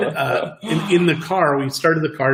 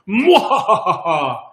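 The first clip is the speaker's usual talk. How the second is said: slow evil cackle; villain's gloating laugh; mad scientist; 5 semitones higher, 3 dB louder, much slower than usual. Retold or imitated. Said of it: "they do the mwahaha afterwards".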